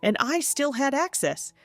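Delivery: a hint of a condescending dad; dismissive father. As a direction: talk down to her as if she is overreacting. Narrator voices the father.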